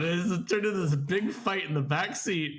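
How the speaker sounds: usual speaking voice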